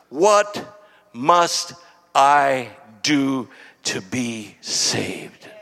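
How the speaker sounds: long pauses between the words; slow, weighty question. Retold or imitated. Jailer asking Paul and Silas for salvation, slow and weighty. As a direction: draw the question out word by word, voice sinking.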